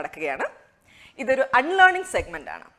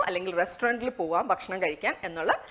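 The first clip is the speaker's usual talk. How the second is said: ordinary delivery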